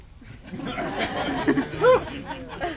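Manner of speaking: high burst of laughter; loud laugh. Real, not acted. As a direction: laugh out hard at the mix-up. Laughing at name mix-up.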